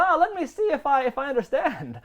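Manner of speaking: high mock British tour guide; lightly imitating a British guide. Tour guide answering the idiots, high mock British voice. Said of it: "he goes".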